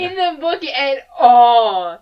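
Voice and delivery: high, loud, near-crying protest; exasperated wail. Real, not acted. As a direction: wail in outrage at the absurd twist. Exasperated rant about unintroduced killer.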